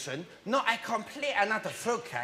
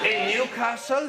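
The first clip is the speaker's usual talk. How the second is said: ordinary talk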